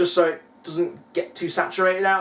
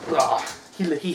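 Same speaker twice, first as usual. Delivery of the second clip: ordinary delivery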